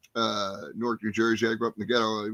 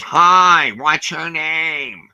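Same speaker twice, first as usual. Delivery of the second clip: louder, very slow, sweet small-woman voice; a tiny kind lady greeting, stretched out; sweet little lady; 4 semitones higher, 8 dB louder, much slower than usual. Retold or imitated. Little lady at sign-up table.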